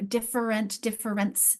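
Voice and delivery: "different" and "difference" with a full middle -FER-; base word audible. Shown as a demonstration. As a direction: keep "defer" audible inside "different, difference". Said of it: "your base word here is defer".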